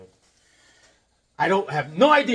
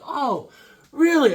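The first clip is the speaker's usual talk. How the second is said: high mock surprise, sharply louder on really; surprised; 8 semitones higher, 4 dB louder than usual. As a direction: act amazed, pitching way up. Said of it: "I was like".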